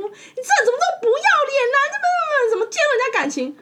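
高八度、带笑地模仿女生尖声质问“你怎么介入人家感情”; 学对方抓狂地骂人，越骂越快; angry girlfriend. Retold or imitated. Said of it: "她就开始，就开始说". (带着笑意模仿女友高声骂人)

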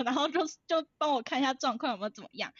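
normal talking voice

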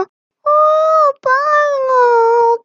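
long, high cry of delight; awed monkey. A narrator's reading. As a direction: draw it out in wonder, high. Monkey admires the view.

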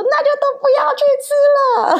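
high, loud, fast mock shout; mock yell. Mother acts out the yell she held back at her son, high and loud. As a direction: blurt it high and fast like snapping at a kid. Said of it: "甚至好想大吼说".

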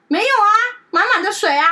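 high and loud, amazed that the bowl is full; the bowl is full, what's wrong; high loud surprise at a full bowl; surprised. Retold or imitated. Owner checks water, surprised.

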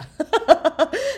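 a loud, high laugh rising up; loud delighted laugh. Real, not acted. Telling listeners she found the ice cream, a loud high laugh.

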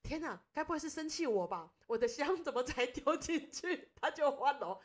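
sudden high, loud alarm, voice jumping up; panicked inner thought. Retold or imitated. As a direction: blurt it out as if the god is angry at you. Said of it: "我就心里想".

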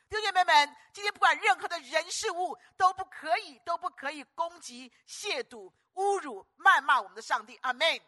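loud, high thundering climax; fiery pulpit climax; full-force preaching. Acted. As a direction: roar the charge at the congregation.

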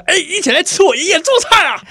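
high, bright calling out; high excited call. Pretend. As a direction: call everyone over, high and eager. Acting dream invitation.

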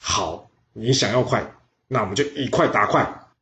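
ordinary delivery

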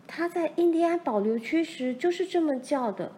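put-on high-pitched girl's voice; Laura recalling. A narrator's reading. Daughter recalls the man's yell, in a girl's voice. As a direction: recall it like an eager child. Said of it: "罗兰回忆着".

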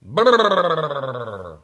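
ordinary talk

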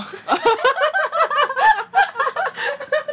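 long, high, loud laughter. Real, not acted. (长时间大笑)

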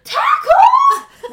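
sudden high shout of a single word, laughing; laughing mock shout from behind. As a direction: jump out and yell the word to startle. Teacher sneaks up behind.

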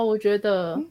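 ordinary talk, nothing marked

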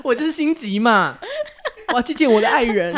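high, laughing eager passenger; giggly impatient passenger. Pretend. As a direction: whine it, giggling, rushing to a lover. (笑着撒娇的急躁乘客)

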